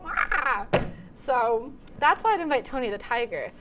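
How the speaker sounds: normal talking voice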